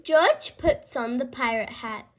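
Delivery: usual speaking voice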